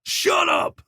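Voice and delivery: high, sharp angry shout; furious high shout; shouting back. A narrator's reading. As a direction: yell at her to stop. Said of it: "Mason shouted back".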